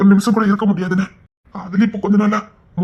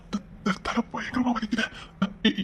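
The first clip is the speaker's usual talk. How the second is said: much softer, faint and strained; in pain; 3 semitones higher, 14 dB quieter, slower than usual. Acted. Father in pain from his headache, barely audible. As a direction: groan it out through a splitting headache.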